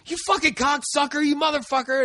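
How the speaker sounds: high-pitched mock-furious cursing; retold road rage. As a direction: act out screaming insults at another driver. Retold road-rage insults at driver.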